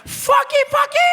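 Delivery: very high, loud hero's yell; hammed-up screamed war cry; mock battle cry. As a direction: parody a rousing last cry. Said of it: "He didn't say freedom. He was like".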